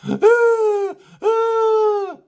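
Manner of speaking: high loud mock wail; high mock crying wail; child wailing. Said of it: "crying his eyes out".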